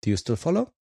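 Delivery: ordinary talk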